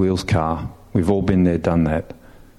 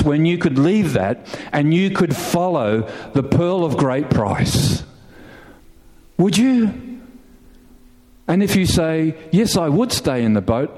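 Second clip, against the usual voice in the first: raised, fervent pulpit voice; full-force preaching; 6 semitones higher than usual. Acted. Pastor preaching to congregation about leaving the boats, fervent. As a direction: preach it higher and stronger to the crowd.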